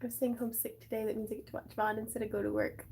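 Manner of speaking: ordinary talk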